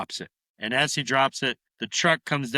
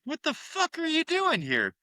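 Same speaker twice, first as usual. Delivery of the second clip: much higher, put-on voice of the shocked uncle; uncle's outburst. Uncle catching the kid in his closet, high startled demand. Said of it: "He's like".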